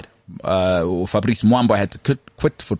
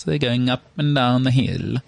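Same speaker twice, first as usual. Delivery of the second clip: slow sing-song Scandinavian lilt; mock Scandinavian accent; 2 semitones higher than usual. Shown as a demonstration. Showing how Danes and Swedes talk, slow sing-song accent. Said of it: "I went Borat there".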